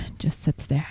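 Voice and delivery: hushed, ominous tease; playful hushed storytelling; mock-suspense whisper. Teasing suspense about unopened box.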